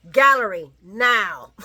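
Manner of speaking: curt order. Retold or imitated. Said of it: "She says".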